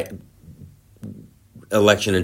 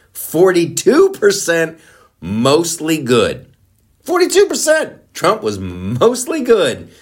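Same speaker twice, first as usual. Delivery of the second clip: gleeful emphasis; 9 semitones higher, 6 dB louder than usual. Real, not acted. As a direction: savor each number like a win. Gleeful poll result emphasis.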